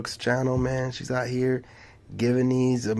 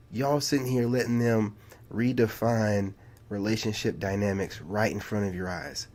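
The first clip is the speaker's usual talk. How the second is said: ordinary delivery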